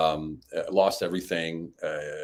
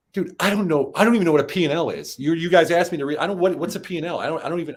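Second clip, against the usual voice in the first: higher, exasperated pleading; retold frustration; 8 semitones higher, 4 dB louder than usual. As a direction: vent like you're in over your head. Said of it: "I was frustrated".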